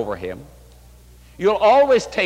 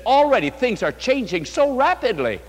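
amazed; 6 semitones higher than usual. Retold or imitated. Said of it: "I'm just amazed".

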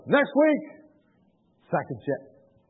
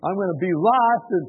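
imitated protest, raised in pitch; mildly distressed complaint; 5 semitones higher, 5 dB louder than usual. Reluctant host tells visitor why he feels doomed, mildly distressed complaint. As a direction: pour out a retold grievance, higher and louder. Acted complaint of frightened man.

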